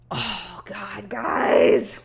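breathy, whispered disbelief; dismayed whisper. Real, not acted. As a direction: whisper it like it hurts. Reacting to grim story detail.

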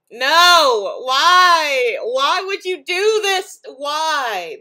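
loud and high, pleading why; real outraged yelling; exasperated outcry. Real, not acted. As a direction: yell at the screen in disbelief. Outraged by a costume meme.

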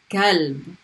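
Kalb with a front, light k. Shown as a demonstration. Teacher modelling the light k for the word dog, light k. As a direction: say Kalb with a plain English-style k. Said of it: "but it's a light K sound".

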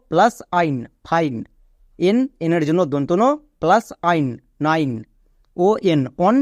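usual speaking voice

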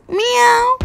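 high, stretched-out meow; slow high cat cry. Shown as a demonstration. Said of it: "here we have the sound of cat".

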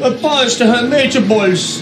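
mock Italian accent. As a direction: join in with a hammed-up Italian accent. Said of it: "Italian's like this".

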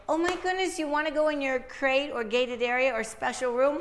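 high, sing-song, overexcited voice to the dog; excited baby talk. Shown as a demonstration. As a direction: gush at the dog like it won a prize. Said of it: "But you say it like that".

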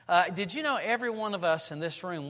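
normal talking voice

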